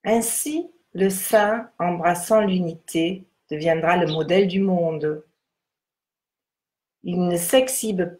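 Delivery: measured, solemn text reading. Acted. Reads the Tao text aloud.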